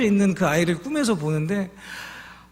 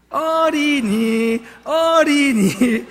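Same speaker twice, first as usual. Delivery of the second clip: sing-song call; 5 semitones higher, 4 dB louder, much slower than usual. Acted. Drawn-out sing-song child call.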